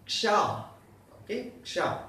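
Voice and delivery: "ksha" with a clipped k blended into sh; "ksha": touch the k and glide into sh; ksha sound demo. Shown as a demonstration. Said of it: "Ksha with a will give me ksha".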